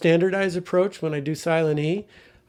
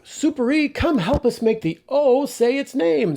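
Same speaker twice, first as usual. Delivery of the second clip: much higher, louder excited call to a hero; calling Super E; 10 semitones higher, 5 dB louder than usual. Acted. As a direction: summon Super E like a cheering classroom hero. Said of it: "and I say".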